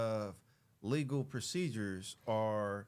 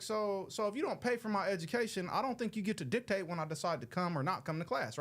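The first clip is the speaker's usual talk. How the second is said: higher-pitched argumentative retort; retold challenge; 7 semitones higher than usual. Student retelling his retort to the professor, challenging. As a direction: argue the point, pitched up. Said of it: "And I was like".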